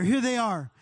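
fervent prayer, declaring and thanking. Acted. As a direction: lift it up like a worship leader praying. Prays aloud over the room.

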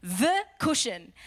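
THE slow and weighty, set apart from "cushion"; stress on THE. Shown as a demonstration. Preacher singling out the one cushion, stress on THE. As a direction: land firmly on "the". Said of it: "not one of many".

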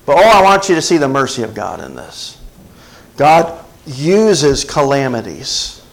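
ordinary delivery

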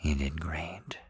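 soft tender whisper, barely voiced. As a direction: breathe it gently, full of praise. Tender whisper after the scene.

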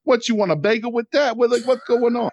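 loud, high-pitched mock Brooklyn accent. Podcast host parodying a failed Russian accent, in Brooklyn accent. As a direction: heckle in an over-the-top Brooklyn accent. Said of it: "it just sounds like".

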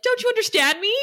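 high, exasperated plea; pleading Giles. Retold or imitated. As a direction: beg to be understood. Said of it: "Giles is like".

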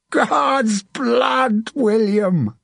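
high-pitched, drawn-out battlefield shout; exultant shout. A narrator's reading. Sir John to his snarling neighbour mid-battle, shouting. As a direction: shout it wildly over the fighting. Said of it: "Sir John shouted".

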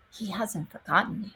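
hushed comforting words; tender reassurance. Real, not acted. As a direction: say it softly like a promise. Tender assurance.

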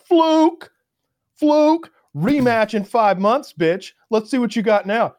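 high, loud jeering "fluke"; mocking crowd shout. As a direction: jeer at her like a crowd.

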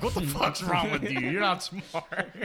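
incredulous put-down that rises and slows into laughter; mock disbelief. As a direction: scold them in laughing disbelief. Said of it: "and I'm like".

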